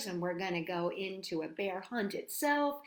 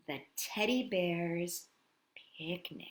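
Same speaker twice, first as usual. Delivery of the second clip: hushed title; 6 semitones lower, 6 dB quieter, slower than usual. Acted. Dramatic hushed book title.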